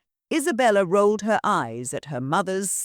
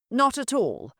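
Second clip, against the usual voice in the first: smooth; 7 semitones higher than usual. Acted. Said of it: "Lord Ravencroft replied smoothly".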